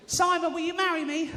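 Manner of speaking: very high squealed proposal; excitable fan. Retold or imitated. Woman in the audience proposing to Simon, high squeal.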